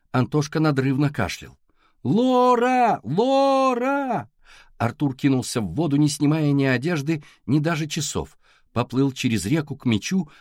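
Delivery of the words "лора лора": high, long, loud calls; calling out. A narrator's reading. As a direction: shout the name across the water. Boy calls for missing sister. (громко и протяжно звать)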